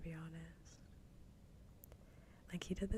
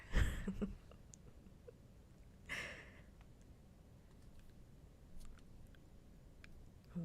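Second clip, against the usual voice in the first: hushed, breathy chuckle; quiet laugh; 3 semitones higher, 4 dB quieter than usual. Real, not acted. Streamer amused by own promise, quiet laugh. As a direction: let out a small, quiet laugh.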